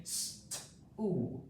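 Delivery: "St-oo" split into its sounds, the oo stretched; sounding out. Shown as a demonstration. Teacher models sounding out a word for pupils, segmented. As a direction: sound out "St-oo" slowly, piece by piece. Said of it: "three sounds in the word stool".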